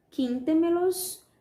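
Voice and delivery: "quíntemelos" slow, high and loud; stress on QUIN. Shown as a demonstration. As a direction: raise and stretch "quíntemelos" from its first syllable QUIN. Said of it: "O quin é a sílaba tônica".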